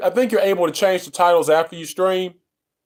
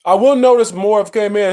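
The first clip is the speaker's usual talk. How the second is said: normal talking voice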